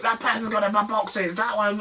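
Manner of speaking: high-pitched mimicked complaint; high, put-on tattling voice; arguing woman. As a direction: whine the accusation like an outraged woman. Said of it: "they were sort of arguing with them".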